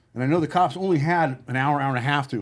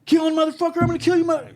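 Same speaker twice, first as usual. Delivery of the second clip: mock raving lunatic, pitched way up; high, louder mock threat; crazed tape voice; 14 semitones higher, 4 dB louder, faster than usual. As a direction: shriek it like the doctored tape of a madman. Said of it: "what he sounded like".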